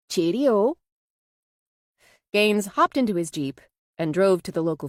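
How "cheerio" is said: high, loud Yorkshire goodbye; Minnie. A narrator's reading. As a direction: chirp a farewell in a Yorkshire accent. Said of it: "Minnie's Yorkshire accent".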